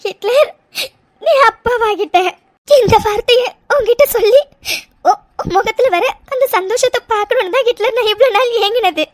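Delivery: overwhelmed, weepy relief; tearful joy. Acted. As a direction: voice full of happy tears. Weeping with joy to husband.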